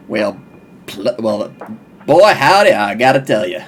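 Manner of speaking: very low Southern cowboy accent; American cowboy accent. Shown as a demonstration. Asked to sound American, overshoots into low Texan accent. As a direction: drop deep into a howdy Texan accent. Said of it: "I'd like you to sound like an American".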